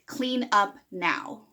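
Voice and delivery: slow, flat "Clean up now." with a falling end; stern command. Shown as a demonstration. Parent ordering the child, slow and stern. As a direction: bark it as an order. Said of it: "That's a command".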